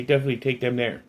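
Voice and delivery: ordinary talk, nothing marked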